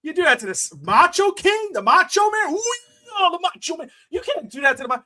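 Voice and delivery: high, heated mock outrage over the finish; worked-up rant. Fan complaining to his co-host, mock outraged. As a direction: get fired up like a fan who's been robbed. Ranting about the match finish.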